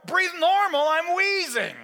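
very high, loud echo; acted exasperated shout; incredulous outburst. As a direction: fling it back in panicked outrage. Patient's outraged echo.

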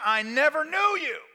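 high, forceful pronouncement; acted divine rebuke; stern rejection. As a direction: cut off their plea with authority.

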